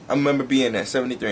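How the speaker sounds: ordinary talk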